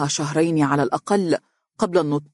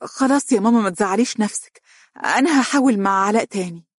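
higher-pitched, quick soothing; eager, lifted voice calming someone; hasty reassurance; 5 semitones higher than usual. A narrator's reading. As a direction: jump in to reassure her. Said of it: "قالت مهرة بسرعة".

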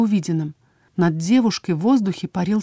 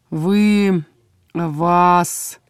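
at a loss. A narrator's reading. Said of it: "Алекс растерялся".

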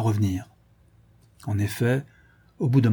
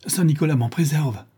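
alarmed cry; 6 semitones higher, 4 dB louder than usual. A narrator's reading. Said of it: "s'écria vivement Igor".

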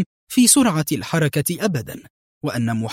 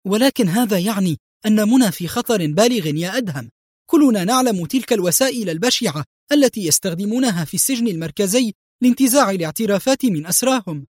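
high-pitched, anxious outcry; worried friend crying out in dismay; alarmed; 7 semitones higher than usual. A narrator's reading. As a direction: plead in alarm, pitch raised throughout. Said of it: "ثم هتف في جزع".

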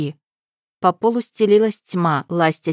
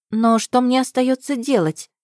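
higher, bewildered question; lost and unsure, pitched up; растерянно; 7 semitones higher than usual. Acted. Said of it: "растерянно спросила". (растерянно, выше)

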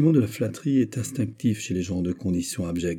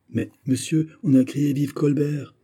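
deeply agitated; 6 semitones higher than usual. Acted. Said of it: "répliqua le chef du guet fort ému".

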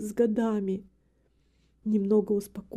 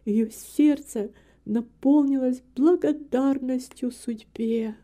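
ordinary delivery

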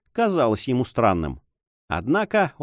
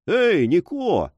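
drawn-out, higher-pitched call; loud call; 6 semitones higher, much slower than usual. A narrator's reading. Sailor summoning Niko to the cabin, calling out. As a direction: call out slowly for someone below. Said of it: "закричал он громким голосом".

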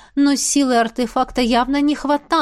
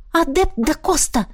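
высоко, с возмущённым изумлением; одёрни его возмущённым окликом; возмущённо; 7 semitones higher than usual. Acted. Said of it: "возмущённо воскликнула я". (возмущённый высокий возглас)

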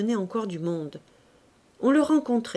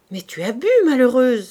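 sharp cry rising louder on malheureuse; 3 semitones higher, 7 dB louder than usual. A narrator's reading. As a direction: call her out, loud and shocked. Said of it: "s'écria Pauline".

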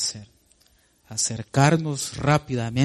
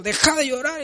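high mock scold to a crying child; impatient parent, pitched high; 11 semitones higher than usual. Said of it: "está llorando".

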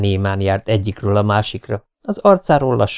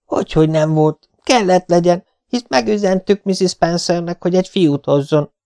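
higher, pressing insistence; insisting; 9 semitones higher than usual. A narrator's reading. Marilla insists to Matthew about the boy. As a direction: argue your point firmly. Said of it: "erősködött Marilla".

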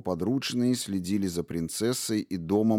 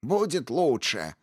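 higher, slow mocking echo; mocking mimicry; 7 semitones higher than usual. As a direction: mimic him with spite. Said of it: "Зло передразнила его предводительница".

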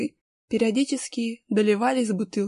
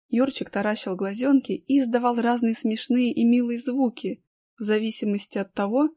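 ordinary delivery, nothing marked

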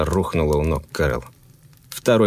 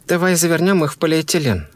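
high woman's voice, insisting; insistent wife; 10 semitones higher than usual. A narrator's reading.

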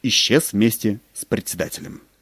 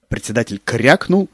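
usual speaking voice

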